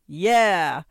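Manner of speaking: loud, drawn-out war cry; long falling battle yell. A narrator's reading. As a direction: bellow it to rouse the raiders. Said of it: "we yelled".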